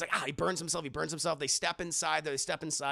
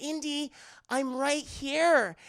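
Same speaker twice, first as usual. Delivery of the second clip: higher, slow drawn-out come-on; slow seductive call; 8 semitones higher, 3 dB louder, slower than usual. Acted. Willie calling Indy back to her, slow and seductive. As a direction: draw it out like a temptress.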